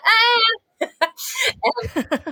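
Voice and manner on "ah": high, loud "ah"; playful imitated cry; mock yell. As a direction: let out a high re-enacted holler. Acted cry back at producers.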